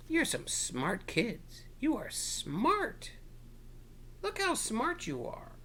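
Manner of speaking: slow, high cheerful praise; encouraging praise. Real, not acted. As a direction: build them up warmly. Praising the children.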